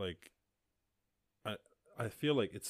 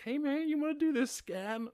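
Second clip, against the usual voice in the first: much higher cheery pest voice; mock computer voice; 4 dB louder than usual. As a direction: voice the laptop as a pushy pal. Voicing the Dell computer.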